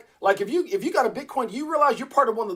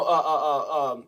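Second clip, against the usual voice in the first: normal talking voice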